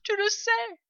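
high-pitched tearful protest; sobbing protest. A narrator's reading. Mother fox contradicts her husband, sobbing. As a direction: plead as if sobbing. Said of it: "sanglotait dame Renard".

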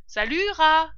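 high, loud parting shout; calling out. A narrator's reading. Foxes fleeing through the hole, calling out goodbye. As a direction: toss a farewell over your shoulder. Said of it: "lancèrent-ils en disparaissant".

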